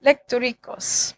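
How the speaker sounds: Lectorikos said slowly, LEK-tor-ee-kos, ending in a fall; Greek word. Shown as a demonstration. Preacher slowly sounds out the Greek word Lectorikos. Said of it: "that's exactly how they said it".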